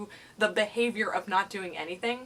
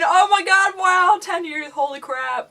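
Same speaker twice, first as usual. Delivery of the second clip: high, loud, amazed outburst; real delighted disbelief, much higher and louder; 9 semitones higher, 11 dB louder than usual. Real, not acted. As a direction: gasp at how long it's been. Amazed at ten-year channel.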